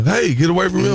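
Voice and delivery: put-on high, sharp yell aimed at a kid; mock yell. Host repeating the cohost's yell at a child. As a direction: throw the cohost's yell back at her. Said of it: "you see how you yell".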